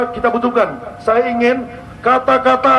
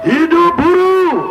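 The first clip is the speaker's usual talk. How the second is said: fiery revolutionary speech; 5 semitones higher, slower than usual. Real, not acted. Rally leader leads a chant.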